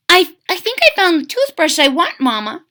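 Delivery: put-on high, loud eager child; a happy kid who found his pick; excited young crocodile. William tells mama he found his toothbrush, excited child.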